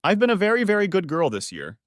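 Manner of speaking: high, teasing coo; a playful seductive coo. Acted. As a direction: coo it flirtatiously over the shoulder. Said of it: "she cooed".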